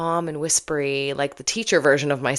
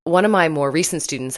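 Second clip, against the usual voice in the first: ordinary talk, nothing marked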